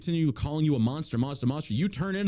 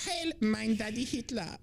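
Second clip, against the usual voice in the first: higher, slow camp German salute; mock German accent; 7 semitones higher, slower than usual.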